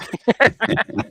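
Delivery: high, amused laugh at own joke; real chuckle, pitched well up. Real, not acted. Speaker kidding about the warm-up outfit, high laugh.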